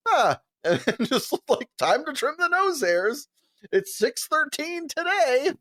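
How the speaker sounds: lightly voiced teammate cheerfully announcing a trim; casual teammate impression. Imitating teammate trimming nose hairs.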